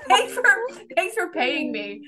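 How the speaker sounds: high squeaky start, slowing down; hammed-up gushing gratitude for a paycheck; gushing sugar baby. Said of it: "I'm like".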